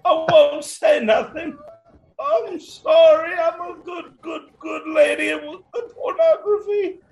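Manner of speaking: high-pitched mock wail; begging old woman. Old woman begging killer Santa not to hurt her, hammed-up wailing. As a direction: plead for your life, hammed-up and squealing.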